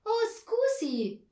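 loud, high, drawn-out exclamation; calling out. A narrator's reading. Herr Marconi offering chairs to his young visitors, calling out. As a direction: cry it out in Italian, high and slow. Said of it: "rief Herr Marconi".

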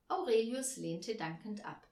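plain reading voice

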